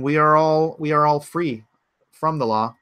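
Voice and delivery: ordinary delivery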